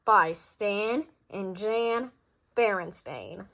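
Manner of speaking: slow, deliberate credit read; author credit. Acted. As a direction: stretch out the author names. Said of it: "author credit read slowly".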